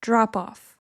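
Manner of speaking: "drop off" said as one word; "drop off" joined, end stressed; linked, stress at end. Shown as a demonstration. Teacher shows drop off in a sentence. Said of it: "it would sound like".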